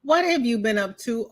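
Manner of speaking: ordinary talk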